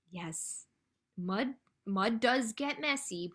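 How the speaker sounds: ordinary delivery, nothing marked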